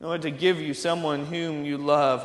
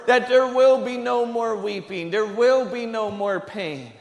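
raised, ringing sermon voice; fervent preaching; 5 semitones higher, 5 dB louder than usual. Acted. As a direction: preach it out with rising fervour. Preacher builds to full force.

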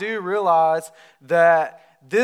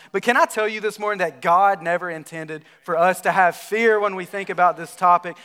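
ordinary delivery

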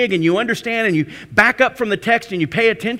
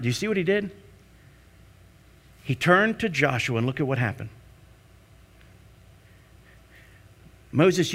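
softer, intimate sermon voice; hushed preaching; 8 semitones lower, 5 dB quieter than usual. Acted. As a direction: draw them in, quiet and earnest.

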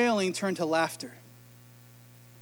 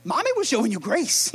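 1 stern mom; 3 semitones higher than usual. Retold or imitated. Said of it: "She's like".

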